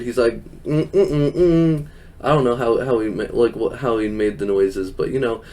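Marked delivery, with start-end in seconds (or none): 0.6-1.9 a light imitation of closed-mouth "mm" grunts, pitched up; imitating Hornswoggle's grunts. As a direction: hum short "mm" grunts like a little wrestler mimicking. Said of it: "like, voice he did".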